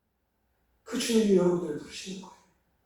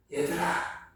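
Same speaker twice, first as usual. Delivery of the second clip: put-on soft, gentle call to the children; softly calling his dear children; tender loving call; 6 dB quieter, much slower than usual. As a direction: call them lovingly, like a fond father. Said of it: "더 친근하게, 더 사랑스럽게".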